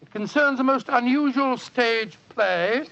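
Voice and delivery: normal talking voice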